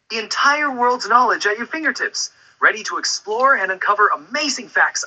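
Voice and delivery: very high, fast, thrilled; super excited. Pretend. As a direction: burst with pure excitement. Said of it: "way more excited than that".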